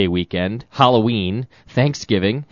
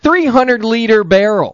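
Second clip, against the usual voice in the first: disgusted exclamation; 11 semitones higher, 7 dB louder than usual. Real, not acted. Disgusted outburst.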